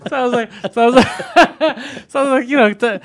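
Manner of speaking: high, loud words through laughter; laughing. Real, not acted. Storyteller cracking up at the co-host's joke, laughing. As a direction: try to go on while cracking up.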